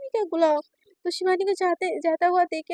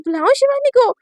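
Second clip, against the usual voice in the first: high and louder; insistent order; 8 semitones higher, 7 dB louder than usual. Acted. Mother threatening to call Shivani, insistent. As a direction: threaten firmly.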